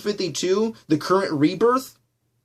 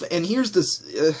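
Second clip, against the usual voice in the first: ordinary delivery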